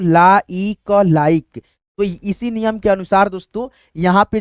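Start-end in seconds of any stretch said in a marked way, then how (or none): 0.0-1.6 ला ई क spelled out slowly, then like blended; slow sounding out. Shown as a demonstration. Teacher sounding out L-I-K-E slowly for students. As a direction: sound out each letter slowly, then join them. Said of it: "क्या पढ़ते हैं दोस्तों?".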